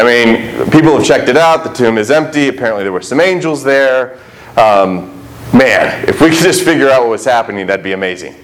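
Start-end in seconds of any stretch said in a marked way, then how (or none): none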